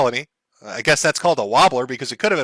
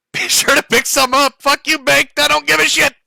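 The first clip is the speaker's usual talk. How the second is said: high, loud mocking yell; angry shout; 10 semitones higher, 4 dB louder than usual. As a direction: hurl it at him, loud. Defiant outburst at heckler Baked.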